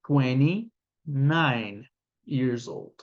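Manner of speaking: put-on "twenty-nine years old" said very slowly; very slow model answer. Shown as a demonstration. Teacher modelling an answer for learners. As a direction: drag out each word of the answer. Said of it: "I am".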